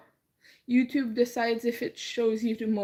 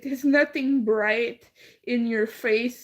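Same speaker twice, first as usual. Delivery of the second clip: usual speaking voice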